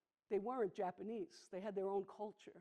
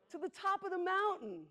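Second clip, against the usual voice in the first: much higher and louder answer; princess Annie; 10 semitones higher, 7 dB louder than usual. Retold or imitated. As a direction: call it out brightly, pitched way up.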